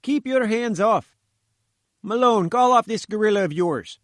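high, loud, struggling sputter. A narrator's reading. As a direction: splutter it while wriggling free. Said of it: "he sputtered".

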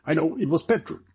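mildly excited realisation; crying out. A narrator's reading. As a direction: blurt the answer out, voice lifted. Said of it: "cried Ricardo".

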